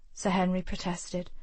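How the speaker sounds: ordinary talk, nothing marked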